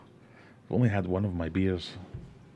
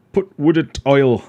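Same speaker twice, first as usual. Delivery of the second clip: loud, slow, broad Sheffield put wood in oil; Sheffield saying; 8 semitones higher, 14 dB louder than usual. Retold or imitated. Streamer imitating Sheffield coworkers, loud slow Sheffield accent. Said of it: "they all have weird sayings like".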